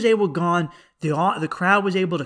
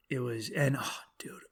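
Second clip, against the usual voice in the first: whisper; 6 semitones lower, 12 dB quieter than usual. Real, not acted.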